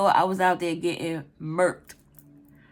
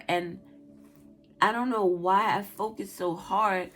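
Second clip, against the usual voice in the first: ordinary talk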